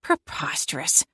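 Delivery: quiet grumbling mutter. A narrator's reading. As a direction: mutter it under your breath. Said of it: "she continued muttering".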